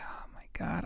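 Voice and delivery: breathy whisper, barely there. Retold or imitated. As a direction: whisper it under your breath. Exhausted inner thought.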